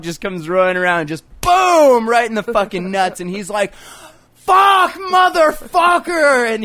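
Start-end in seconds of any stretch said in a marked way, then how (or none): 4.3-6.5 high, loud cry of pain; pained shout. Retold or imitated. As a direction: yell it like you just got hit. Guy just kicked in the nuts.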